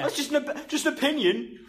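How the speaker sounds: high, whiny sarcastic parody; mocking high voice. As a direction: squeak it out to mock him. Mocking the rugby pundit.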